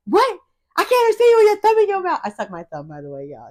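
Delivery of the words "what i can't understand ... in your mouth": high, fast mocking brother voice; imitating brother. Brother complaining about thumb-sucking speech, high mocking imitation. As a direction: mimic the brother, pitched way up and quick. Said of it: "he be like".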